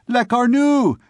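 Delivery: high, loud mocking yell; jeering shout. A narrator's reading. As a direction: jeer at him. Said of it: "said some".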